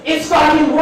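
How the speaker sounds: high-pitched mock yell; Gordon Ramsay. Comic mimics a TV chef berating cooks, mock yell. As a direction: imitate an angry chef yelling. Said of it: "yells at people. He's like".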